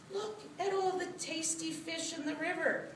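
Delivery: high-pitched rat luring a friend; tricky rat. A narrator's reading. Rat tricking Cat into looking at the river, high voice. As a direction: pitch it high, tempting and sly.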